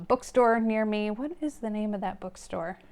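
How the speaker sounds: ordinary delivery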